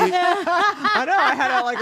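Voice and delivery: laughing. Real, not acted. Laughs at the deep voice remark.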